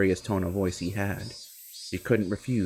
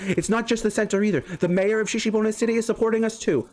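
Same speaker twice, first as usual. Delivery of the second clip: put-on higher, urgent boyish hero; eager Keiichi; 9 semitones higher than usual. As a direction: reassure her she'll be saved. Keiichi calls to rescue Satoko.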